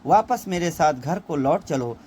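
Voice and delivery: ordinary talk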